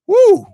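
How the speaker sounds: loud high whoop; excited whoop. Real, not acted. Host hears the all-defensive question, loud high excited whoop. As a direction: let out a big excited whoop.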